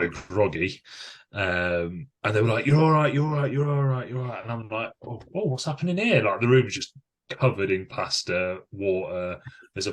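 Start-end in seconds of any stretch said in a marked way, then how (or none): 2.7-4.4 higher, louder soothing repetition; calm him down, over and over; mock nurse soothing a confused patient; reassuring staff. Staff calming a dazed patient. 5.1-6.2 much higher, bewildered waking-up voice; like coming round, lost; mock groggy bewilderment; dazed confusion. Waking up confused after passing out.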